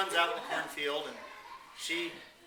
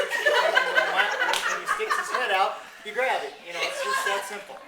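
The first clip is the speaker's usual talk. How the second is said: real laughter breaking the words, high and loud; laughing through it; 6 semitones higher, 8 dB louder, slower than usual. Real, not acted. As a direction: fight through giggles to get it out. Teller laughing through the punchline.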